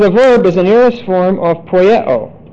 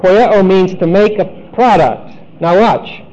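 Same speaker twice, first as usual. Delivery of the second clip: ordinary talk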